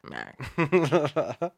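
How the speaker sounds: playful try at Boston "Mac"; Boston accent. Shown as a demonstration. Copying the friend's word, attempted Boston accent. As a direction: imitate a Bostonian saying Mark.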